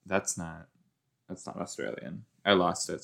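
usual speaking voice

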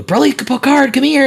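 high-pitched, coaxing call to pets; calling dogs. Acted. Owner summoning roommate's dogs, calling out.

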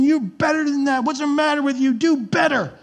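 angry parent barking criticism; scolding condemnation. Retold or imitated. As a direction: snap at the kid for messing up. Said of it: "You don't scream condemnation".

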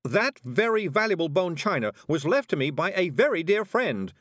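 high, loud, indignant shout. A narrator's reading. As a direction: shout it in protest, every word pushed. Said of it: "shouted Miss Level".

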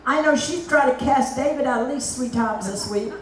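ordinary talk

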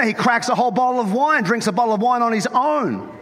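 ordinary talk, nothing marked

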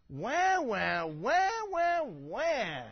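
loud, high mock baby wail; mock crying. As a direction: wail like a whining baby, hammed up. God mocks complaining people.

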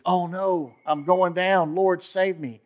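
acted plea for rescue; mock cry for help. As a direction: call out as if going under. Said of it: "And he says".

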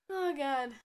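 usual speaking voice

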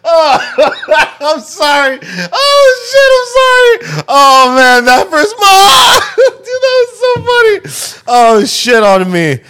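crying with laughter; laughing hard. Real, not acted. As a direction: wheeze through tears of laughter. Cracking up after the scream.

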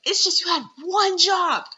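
rising to a high, loud ONE; exasperated outburst. Real, not acted. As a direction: hit the final ONE high and hard. Exasperated rant at absent person.

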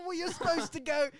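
high, loud mock indignation; outraged manager. Manager tells band how the song goes, indignant. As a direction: scold them, voice pushed up.

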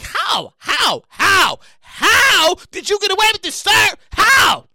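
high, loud repeated how; disbelieving shout. Real, not acted. Yelling at the culprit in disbelief. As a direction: demand an answer, yelling.

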